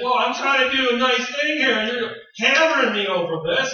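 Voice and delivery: high, loud, exasperated protest; husband defending himself to upset wife, loud and exasperated; defensive husband. Retold or imitated. Acted marital quarrel.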